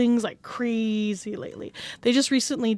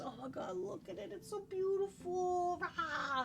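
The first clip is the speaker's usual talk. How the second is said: much softer, higher awed murmur; hushed awe; 6 semitones higher, 16 dB quieter than usual. Real, not acted. Streamer admiring her model, hushed awe. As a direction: gaze at it in quiet wonder.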